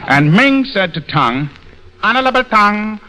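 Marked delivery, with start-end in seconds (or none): 2.0-3.0 higher-pitched, put-on Chinese character voice; mock Chinese accent. Ming asking Tong about last night, mock Chinese accent. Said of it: "two fellas named Ming and Tong".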